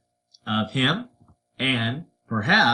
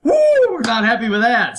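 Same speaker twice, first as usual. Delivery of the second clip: higher, louder hammed-up indignant cry; mock outrage; 8 semitones higher, 7 dB louder, faster than usual. The French reacting to the insult with a hammed-up loud outraged cry. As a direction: play the offended French for laughs. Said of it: "are like".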